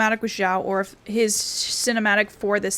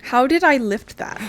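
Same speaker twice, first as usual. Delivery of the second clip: louder, higher, baffled outburst; surprised; 4 semitones higher, 6 dB louder than usual. Real, not acted. Streamer baffled that his character lifted the censer, surprised outburst. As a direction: blurt it out in disbelief.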